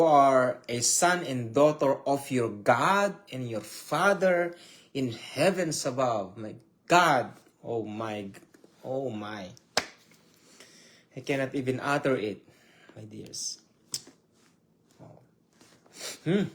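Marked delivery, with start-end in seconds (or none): 6.4-15.2 soft, low, overcome voice; real hushed awe; awed hush. Real, not acted. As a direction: sink into quiet awe. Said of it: "I have goosebumps all over me".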